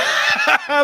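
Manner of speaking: high loud burst of laughter. Real, not acted. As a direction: crack up. Laughing at own joke.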